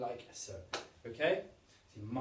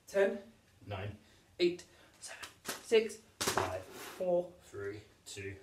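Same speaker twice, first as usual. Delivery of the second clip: put-on playful kids' countdown, pitched up; silly countdown; 3 semitones lower, 3 dB louder, slower than usual. Shown as a demonstration. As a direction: count down in a goofy voice. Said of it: "I said that in a really silly way".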